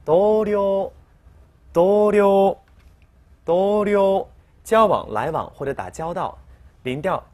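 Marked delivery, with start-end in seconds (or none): 0.1-4.3 同僚 called out loudly, level tone; emphatic model reading. Shown as a demonstration. Teacher drilling 同僚 loudly for students to repeat. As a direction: belt out 同僚 like a class drill. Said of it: "合起来".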